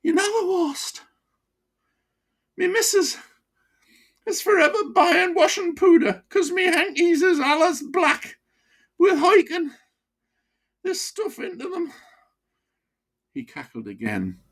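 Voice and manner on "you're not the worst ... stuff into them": put-on high, broad Wearside voice of an old miner; chatty old pitman with a wheezy chest. Old miner to his doctor, broad Wearside.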